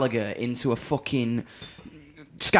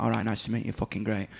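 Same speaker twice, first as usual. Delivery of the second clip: deadpan northern; 4 semitones lower, 6 dB quieter, faster than usual. Retold or imitated. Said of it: "I'm dry and northern".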